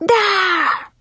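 high wordless shriek of rage; frustrated shriek. A narrator's reading. Rival losing it at Nikki, frustrated angry shriek. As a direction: shriek as words fail you. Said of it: "she shrieked".

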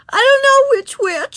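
put-on very high, loud little girl; upset child's whiny cry; tearful wail. A narrator's reading. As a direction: wail it helplessly to the teacher. Tearful Susan answers the teacher.

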